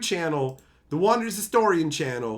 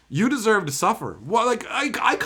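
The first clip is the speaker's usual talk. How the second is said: normal talking voice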